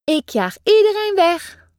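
fierce call. Pretend. Child playing fierce gull.

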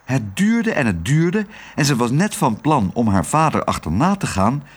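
usual speaking voice

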